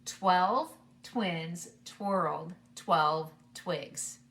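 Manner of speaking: very slow, each word spaced out; nice and slow. Shown as a demonstration. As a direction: say it slowly for kids to follow. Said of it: "I'll say it first, nice and slow".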